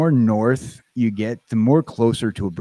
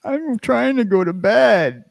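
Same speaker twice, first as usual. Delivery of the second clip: sleepy annoyed friend; 12 semitones higher than usual. Retold or imitated. Host mimics his UK friend complaining he keeps waking her, high whiny voice. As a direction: whine it up high like a tired friend begging to sleep. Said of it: "She's like".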